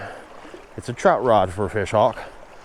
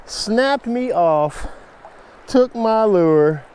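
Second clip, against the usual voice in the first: disbelief; 8 semitones higher, slower than usual. Real, not acted. Repeating the loss slowly.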